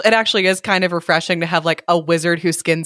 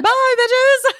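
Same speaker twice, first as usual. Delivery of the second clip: high, laughing taunt; gleeful farewell. Acted. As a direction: sing out a cheeky high-pitched goodbye. Gleeful acted parting shot.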